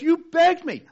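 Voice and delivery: high, loud exasperated accusation; indignant king. Acted. King to ungrateful servant, loud exasperated rebuke. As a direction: throw his own begging back at him. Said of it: "the king calls the first servant in and he says".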